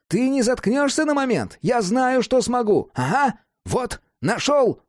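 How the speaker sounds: high-pitched testy retort turning triumphant; irritated, excited Cat. A narrator's reading. Cat silences Jack while repairing the lamp, irritably excited. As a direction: snap back high, then crow at the discovery.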